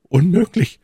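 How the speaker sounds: high, loud exclamation of shock; high-pitched astonished servant; startled disbelief. A narrator's reading. Frightened servant recognises the visitor.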